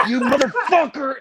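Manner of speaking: high-pitched retold burst of rage; re-enacted fit of temper; angry outburst. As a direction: curse out the cymbal in a high voice.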